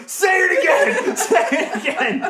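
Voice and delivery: high, loud, slow yelled dare cracking up; mock shout while laughing. Retelling a small coworker daring the big guy while laughing. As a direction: imitate the little guy's challenge through laughter. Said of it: "this little guy going".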